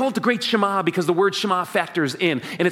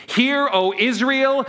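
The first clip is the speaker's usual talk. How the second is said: slow, raised call to recite together; leading a recitation; 7 semitones higher, 4 dB louder, slower than usual. Shown as a demonstration. Pastor leading the standing congregation aloud, solemn. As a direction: lead the crowd in the creed. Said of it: "say it in English with me".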